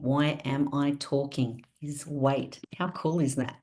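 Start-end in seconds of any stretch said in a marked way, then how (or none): none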